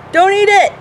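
high, loud command; sharp warning. Real, not acted. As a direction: call out a sudden warning to the dog. Scolding the dog mid-talk.

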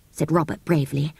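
usual speaking voice

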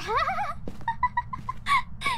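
high burst of giggling; laughing. Real, not acted. Player teasing friend about movies, laughing. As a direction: crack up in a long, high laugh.

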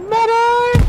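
high urgent call; man down shout. Real, not acted. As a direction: yell for help across the field. Calling for a medic.

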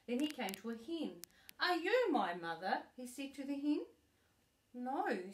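animated read-aloud, bigger at each question; lively storybook reading. Acted. Grandma acting out the story for her granddaughter, lively storybook voice. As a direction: read it with growing excitement for the child.

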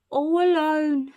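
high, slow, drawn-out sympathy; hammed-up poor-little-thing voice; exaggerated pity. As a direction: coo it slowly and loudly in pity. Burglar pities the abandoned baby.

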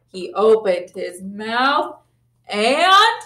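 usual speaking voice

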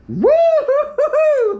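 long high whooping cheer; excited whoop. Real, not acted. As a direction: whoop it out, thrilled. Excited cheer at moment of truth.

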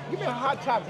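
normal talking voice

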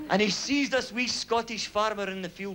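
normal talking voice

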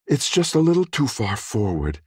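high female customer explaining; young woman's voice. A narrator's reading. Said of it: "She was pointing out the seat of her bicycle to Oscar".

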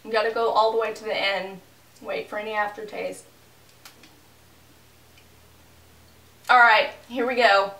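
talking while chewing; speaking between bites; mouth full. Real, not acted. Seen 0: Holds bar at mouth throughout; face small, chewing implied by caption.

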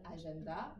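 "agenda" in French, syllables evenly weighted; French pronunciation. Shown as a demonstration. Teacher contrasts languages for students, "agenda" in even French syllables. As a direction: say "agenda" the French way, ending on a rise. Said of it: "en français, on va dire".

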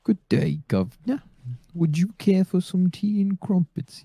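high, loud hammed-up British accent; mock British. Offering the governor tea and crumpets, mock British accent. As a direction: put on a cartoonish British accent, slow and high.

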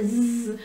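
long voiced buzzing zzz; zebra buzz. Shown as a demonstration. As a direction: buzz like the letter Z between vowels. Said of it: "ele fica zunindo, imitando uma zebra". (zumbido de zebra, zzz prolongado)

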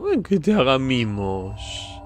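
put-on very slow, drawn-out baby-talk coo; doting cooing. Cooing at a gnome. (arrullo mimoso y muy alargado)

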